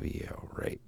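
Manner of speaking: breathy aside under the breath; sceptical whisper. Retold or imitated. Doubting the boss's promise, a sceptical whisper. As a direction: whisper it like a private thought you'd never say aloud. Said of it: "I thought to myself".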